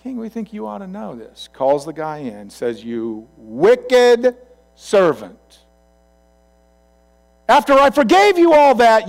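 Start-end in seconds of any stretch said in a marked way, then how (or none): 0.0-1.5 high-pitched, soft acted report; tattling servants. Fellow servants informing the king, high and deferential. 2.8-9.1 loud rebuke rising into a high shout; angry king. Acted. King confronting the unforgiving servant, furious and building. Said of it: "moved with anger".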